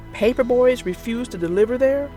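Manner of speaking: plain reading voice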